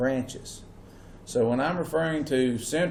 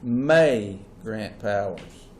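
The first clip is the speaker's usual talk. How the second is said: "may" stretched long and stressed; slowly, leaning hard on "may"; 3 semitones lower, much slower than usual. Shown as a demonstration. Teacher dictating notes to be underlined, stress on "may". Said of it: "I'd underline the word may".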